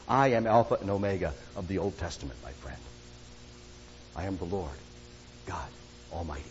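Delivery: soft, low, reverent voicing of Jesus; hushed solemn. Preacher voicing Jesus to his friends, hushed and low. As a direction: drop it to a hush, full of awe.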